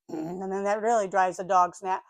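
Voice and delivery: ordinary talk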